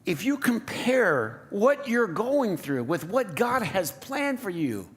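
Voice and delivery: ordinary talk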